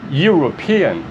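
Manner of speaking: European said with a Y sound at the start; English pronunciation. Shown as a demonstration. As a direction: start European with a clear Y glide. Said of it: "Como é que se pronuncia essa palavra?". (European com som de Y no início)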